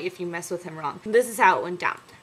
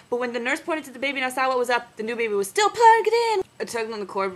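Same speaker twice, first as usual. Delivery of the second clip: ordinary talk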